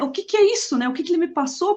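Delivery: hammed-up fear about the cream; mock panicked questions. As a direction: fret aloud in a high frightened voice. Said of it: "desesperado no telefone".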